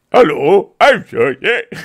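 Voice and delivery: loud, hammed-up self-impression; Sir Sick. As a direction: boom out a mock streamer intro. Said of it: "That was me doing an impression of myself".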